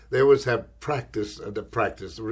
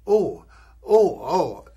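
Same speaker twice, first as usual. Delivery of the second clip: loud, high startled oh oh; flustered surprise; 4 semitones higher, 8 dB louder, much slower than usual. Retold or imitated. Winner stunned by his name, loud, high startled oh. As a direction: caught totally off guard.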